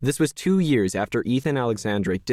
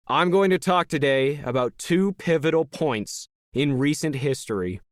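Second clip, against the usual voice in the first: raised pitch, measured public oratory; rally speaker; 6 semitones higher than usual. Acted. Leader tells the crowd of recent history, stage oratory. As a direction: address the hall like a practised orator.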